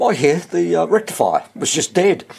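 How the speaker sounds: ordinary talk, nothing marked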